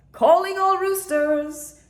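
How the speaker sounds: high, loud, slow call. A narrator's reading. Buffalo summoning roosters, loud slow call. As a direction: call them in like a conductor. Conducting the animals.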